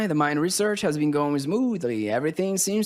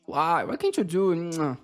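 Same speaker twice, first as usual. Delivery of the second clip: usual speaking voice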